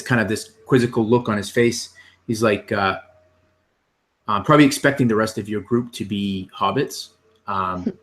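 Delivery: ordinary delivery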